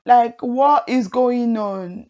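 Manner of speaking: very slow, every word stretched; repeating the traffic complaint slowly in disbelief; drawn-out disbelief. Retold or imitated. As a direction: stretch it out, still baffled. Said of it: "I'm like".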